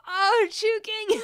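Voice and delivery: high, slow, loud call of a name; real tearful exclamation; moved outcry. Real, not acted. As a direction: cry out to the viewer, deeply touched. Moved, calling out a viewer's name.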